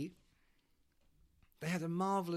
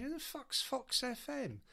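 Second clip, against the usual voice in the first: incredulous; 10 semitones higher, 3 dB quieter than usual. Retold or imitated. Incredulous question, quoted.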